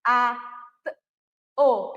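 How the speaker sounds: "a", "t", "o" said one at a time with pauses; sounding out each phoneme for a child; phoneme segmentation. Shown as a demonstration. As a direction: split "ato" into separate sounds a, t, o. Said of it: "eu tenho um".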